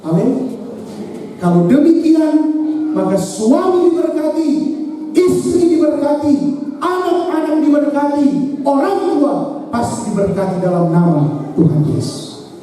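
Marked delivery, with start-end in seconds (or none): 1.4-12.5 high, emphatic declaration of blessing; impassioned preaching. Real, not acted. Preacher declaring blessing on whole families, impassioned. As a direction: build a chant-like run of blessings, pitched high.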